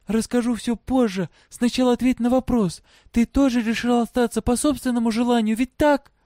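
high-pitched, urgent questioning; pressing girl. A narrator's reading. Girl demanding the truth from her father, pressing. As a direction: press for an answer, high and tense.

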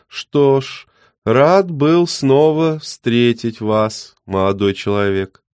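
put-on high, loud, slow old man; courtly old man, unhurried.

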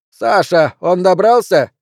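calling out. Acted. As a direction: call out across the room. Calling over intercom.

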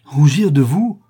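loud, high cry of disbelief, rising at the end; proud indignant exclamation. A narrator's reading. Prince answering a girl who fears her father's shame, proudly indignant. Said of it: "s'écria Rodolphe en se redressant le front altier".

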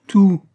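"tout" high and loud; emphatic repeat. A narrator's reading. Woman allows her lover everything, "tout" cried high and loud. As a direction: hit "tout" with a high, loud, falling cry.

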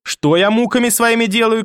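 high-pitched, furious challenge; outraged cry. A narrator's reading. Grandmother rounds on mother with the statuette, outraged cry. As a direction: explode in indignation, pitch leaping up. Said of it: "крикнула бабушка".

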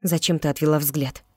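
plain reading voice

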